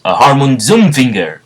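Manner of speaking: hammed-up move name; battle cry. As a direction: announce the finishing move with gusto. Calls out the attack name.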